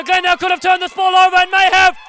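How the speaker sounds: high, loud, roaring title call; ecstatic commentary. Real, not acted. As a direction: scream the championship home. Final whistle, title won.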